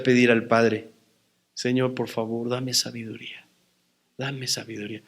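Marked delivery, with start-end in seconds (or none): 1.5-5.0 quiet pleading prayer. Retold or imitated. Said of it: "empecé a clamar".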